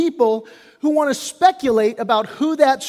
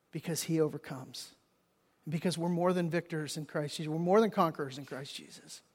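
soft encouragement; 5 semitones lower, 9 dB quieter than usual. Real, not acted. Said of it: "soft, intimate encouragement".

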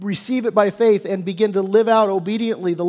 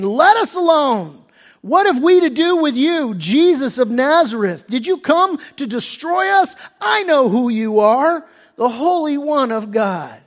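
higher and louder, shouted; demon's loud cry; 5 semitones higher than usual. Acted. Preacher voicing the demon crying at Jesus, shouted. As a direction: cry out like the possessed man. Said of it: "cried out with a loud voice".

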